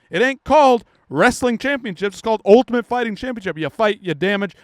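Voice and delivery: normal talking voice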